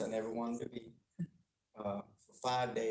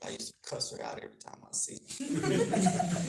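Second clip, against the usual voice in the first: laughing; 8 semitones higher, 6 dB louder than usual. Real, not acted. Laughing while confessing rudeness.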